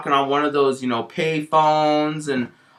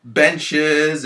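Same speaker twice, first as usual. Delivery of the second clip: usual speaking voice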